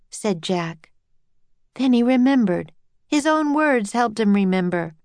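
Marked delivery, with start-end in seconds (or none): none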